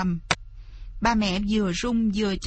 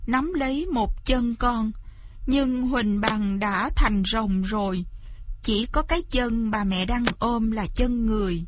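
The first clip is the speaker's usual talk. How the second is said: plain reading voice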